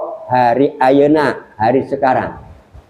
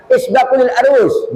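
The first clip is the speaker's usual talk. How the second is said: imitating Saudis; 10 semitones higher than usual. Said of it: "kalau yang namanya ibu mau makan".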